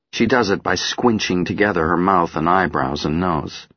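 ordinary delivery, nothing marked

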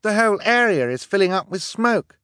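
very high, loud alarm; crying out. A narrator's reading. Sister cries out to her siblings as the smoke thickens. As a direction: call out in alarm. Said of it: "Violet cried".

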